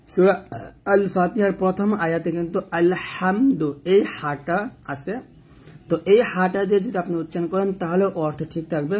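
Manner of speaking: ordinary talk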